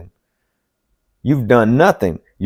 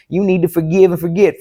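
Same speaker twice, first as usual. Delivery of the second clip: high mocking voice of the advisers; high, mocking imitation of do-gooders; mocking the preachers; 5 semitones higher than usual. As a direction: sneer their advice back in a higher voice. Voicing people who preach forgiveness.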